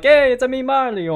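high, loud cartoon Italian Mario voice; mock Italian accent. Podcaster mimicking a star's boast of his Italian voice, mock Italian accent. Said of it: "That's my Italian voice".

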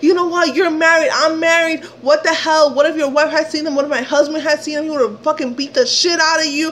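high, heated tirade; ranting at him. Retold or imitated. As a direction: tear into him with indignant questions. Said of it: "I'm, like, ramming him a new one".

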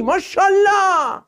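drawn-out high ماشاءالله; mock praise. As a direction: tease the student with exaggerated praise. Praising a student's answer.

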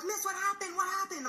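very high, mock-alarmed kid voice. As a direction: imitate kids clamoring in a high pitch. Alarmed kids asking her, retold.

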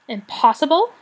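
high, loud mocking echo; sarcastic. A narrator's reading. The rat mocking a dragon's boast, sarcastic. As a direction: scoff back the word with scorn. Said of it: "Rat laughed sarcastically".

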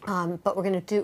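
normal talking voice

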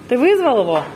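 ordinary talk, nothing marked

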